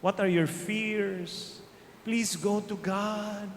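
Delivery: normal talking voice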